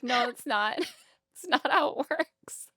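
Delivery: high-pitched, breaking into laughter; laughing. Real, not acted. Debunking the cop's overdose panic, laughing. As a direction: talk through real laughter.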